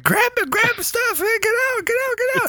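high-pitched mock panic of people fleeing; mimicked panicked yelling. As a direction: imitate a household yelling in a frenzy. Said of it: "everybody's screaming and yelling".

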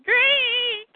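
high, long-held monster screech; monster's cry. Acted. Giant monster bursting out of nowhere, high screech. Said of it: "giant multi-eyed monster thingy ... and says".

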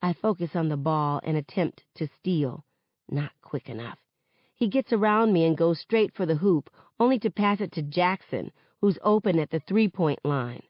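ordinary delivery

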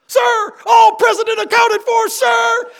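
high, loud screamed report; screaming. Retold or imitated. Cadet screaming his report to the sergeant: loud, high yell. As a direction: scream it out at full attention. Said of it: "Woody screaming that out".

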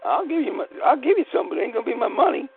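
a high, laughing dare to the robber; mocking taunt. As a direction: sneer it up high like he's a joke.

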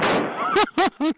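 high-pitched mocking chuckle; taunting laugh. Real, not acted. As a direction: laugh in the other caller's face. Taunting laugh during phone argument.

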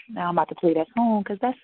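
usual speaking voice

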